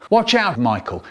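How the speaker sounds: normal talking voice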